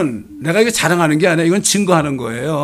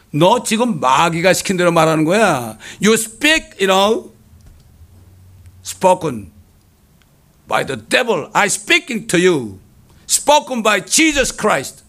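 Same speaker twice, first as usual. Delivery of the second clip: drawn-out, raised-voice exorcism-style rebuke; re-enacted commanding rebuke; forceful rebuke; 4 semitones higher, 3 dB louder, much slower than usual. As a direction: confront him with slow, emphatic English and Korean. Acted rebuke of approaching gang member.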